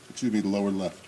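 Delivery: ordinary delivery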